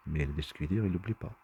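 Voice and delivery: ordinary delivery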